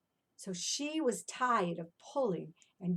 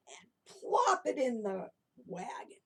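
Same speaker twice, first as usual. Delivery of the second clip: ordinary talk, nothing marked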